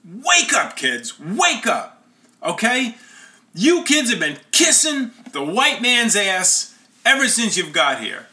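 high-pitched, impassioned retold outburst; imitated tearful yell. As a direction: act out an upset boy yelling at the group. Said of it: "he's in tears, and he yells".

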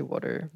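"water" as "wooder"; Philly "water". Shown as a demonstration. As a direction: say "water" with the vowel of "wood". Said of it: "I don't say water".

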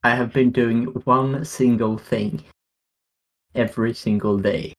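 ordinary delivery

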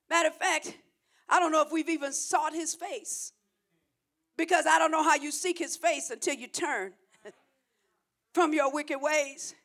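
ordinary talk

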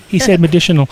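hammed-up, quick playground tease; mock teasing crowd. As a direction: imitate onlookers pouncing on a slip. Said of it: "everybody would be like".

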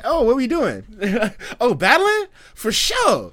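hammed-up buddy itching to fight; eager Hop. Gamer voicing Hop joining a battle, high eager excited voice. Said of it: "he's like".

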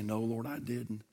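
bowed-head answer to the Lord, hushed and sheepish; sheepish confession. Retold or imitated. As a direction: admit it humbly under your breath. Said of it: "bow my head and say".

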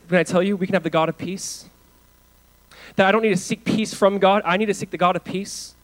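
normal talking voice

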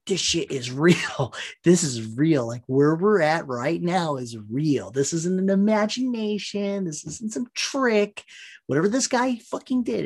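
imitating a counselor swearing it is real; Deanna Troi insisting. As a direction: start steady, then push it up urgently. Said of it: "she's like".

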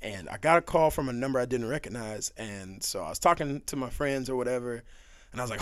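ordinary talk

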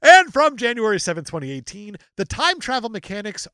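big announced opening, emphatic read; dramatic review read. Acted. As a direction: announce the date loudly, then read with punch. Reads an online review aloud.

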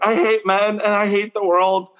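high, whiny mock-tearful rant; mock outrage. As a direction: play the wounded baby, half laughing. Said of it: "I was like".

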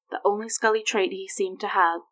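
ordinary delivery, nothing marked

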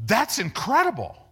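high, loud acted amazement; amazed exclamation. Acted amazed head of school.

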